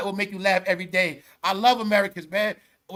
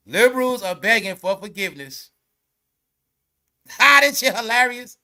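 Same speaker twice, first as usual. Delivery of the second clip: ordinary talk, nothing marked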